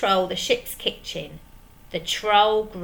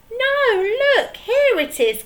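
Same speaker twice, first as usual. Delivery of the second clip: much higher, louder eager cry; excited cry; 6 dB louder than usual. Acted. Peg Polkadot crying out to the pirates, excited. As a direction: call out the find with glee. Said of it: "cried Peg Polkadot".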